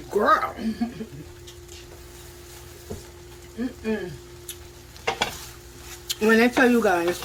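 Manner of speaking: talking with a mouth full of food. Real, not acted. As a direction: speak through a mouthful. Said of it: "sorry for talking with my mouth full".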